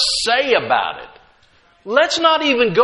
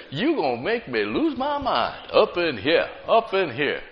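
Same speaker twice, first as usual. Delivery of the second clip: soft mock quote; 3 semitones lower, 6 dB quieter than usual. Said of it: "many times".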